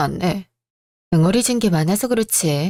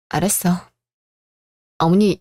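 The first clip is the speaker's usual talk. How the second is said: ordinary talk, nothing marked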